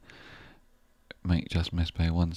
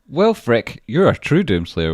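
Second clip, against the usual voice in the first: much higher, louder shout of the name; excited call-out; 6 semitones higher, 10 dB louder, slower than usual. Real, not acted. Streamer shouting out a generous donor by name, thrilled. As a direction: hail the donor with delight.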